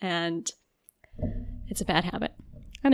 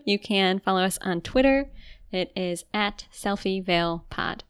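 ordinary talk

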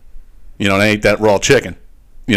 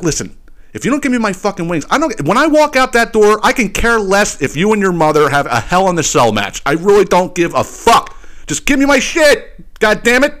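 higher, rising angry rant; exasperated ultimatum; 7 semitones higher than usual. Retold or imitated. Fed-up customer demanding his wings from the son, angry ultimatum.

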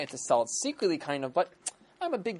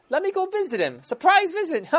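king; 7 dB louder than usual. Acted. King decides on visit.

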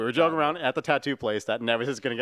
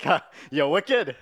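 grandma's raised Trinidadian exclamation, imitated; Trini accent; 4 semitones higher, much slower than usual. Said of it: "In a Trini accent".

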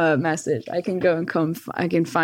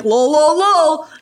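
higher and louder, gleeful mocking chant; teasing dad; 8 semitones higher, 5 dB louder, slower than usual. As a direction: laugh it off at her like the dad did. Voicing dad's reply.

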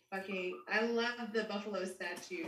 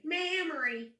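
Memory drawled Southern as "Mammary"; Southern-twanged Memory; southern accent; 7 semitones higher than usual. Shown as a demonstration. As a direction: stretch the first vowel of Memory into a Southern "mam". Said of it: "it's in her southern accent".

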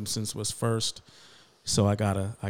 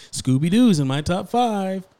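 mock quote; 8 semitones higher, 8 dB louder than usual.

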